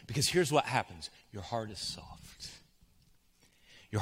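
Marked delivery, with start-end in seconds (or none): 1.3-2.5 a hush on "soft". Acted. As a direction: say "Your heart is soft" softer and slowly, almost breathed. Gentle pastoral emphasis.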